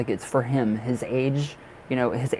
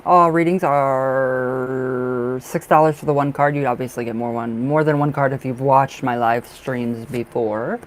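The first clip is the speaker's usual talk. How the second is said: announcement read; 4 dB louder than usual. Acted. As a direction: spell the pricing out like a shop announcement. Reads out her price notice.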